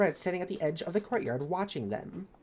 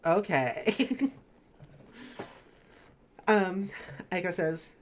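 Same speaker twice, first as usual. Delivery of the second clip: normal talking voice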